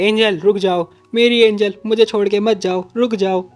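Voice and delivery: pleading call. Retold or imitated. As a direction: beg her to stay as if it were a breakup. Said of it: "जैसे कि सच में breakup हो गया हो". (जाती हुई प्रेमिका को ऊँची आवाज़ में पुकारना)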